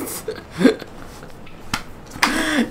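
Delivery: high airy laugh with no voice; breath of a helpless laugh; silent wheezing laugh. Real, not acted. Laughing at co-host joke.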